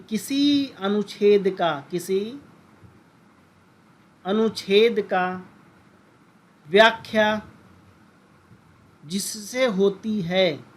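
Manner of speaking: slow, word by word with pauses; slow dictation. Shown as a demonstration. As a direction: dictate the definition for notes. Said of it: "तो तुम बोलोगे". (धीरे-धीरे, रुक-रुककर परिभाषा बोलना)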